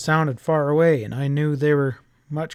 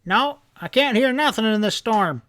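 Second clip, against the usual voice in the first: raised, higher answering shout; shouting back; 8 semitones higher than usual. A narrator's reading. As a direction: call back over the roaring storm. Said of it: "Grandpa shouted back".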